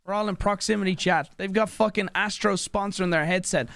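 normal talking voice

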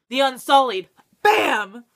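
loud, punchy cry of victory; loud gleeful shout of triumph; triumphant shout. Real, not acted. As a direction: nail the answer and celebrate. Triumphant find for letter U.

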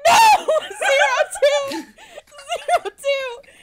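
high, loud desperate yell, cracking into laughter; frantic shout. Retold or imitated. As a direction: scream the correction over the noise. Shouting correction to the idol.

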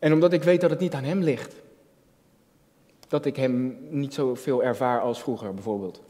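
ordinary talk

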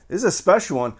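normal talking voice

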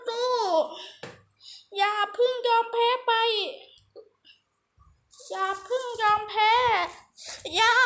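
high-pitched, drawn-out hammed-up begging; desperate pleading. Player begs his side not to give up, desperate high pleading. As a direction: plead in short high cries, stretching the last into a wail.

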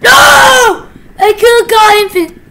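high, loud cry of loss; anguished shout. Real, not acted. Gamer shouting as he misses the infinite crate. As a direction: shout out in frustration.

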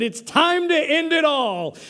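a hammed-up high cry as he leaps; mock dramatic leap cry; high-pitched mock cry. Homeless man jumps into baptistry.

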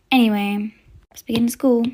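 ordinary delivery

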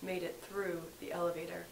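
hushed wildlife documentary narrator; nature documentary. Acted.

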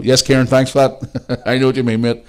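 ordinary talk, nothing marked

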